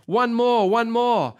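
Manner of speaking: high, insistent imitated chant; crowd calling out. Pastor translating the crowd's cheer for the orator, calling out.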